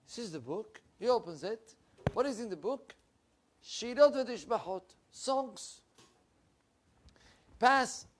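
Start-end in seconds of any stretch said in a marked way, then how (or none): none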